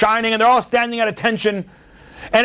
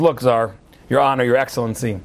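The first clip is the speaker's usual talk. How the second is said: lower, humble soldier voice; a hint of a nervous private pleading; timid private; 9 semitones lower than usual. Private addressing the Tsar, lightly voiced and humble.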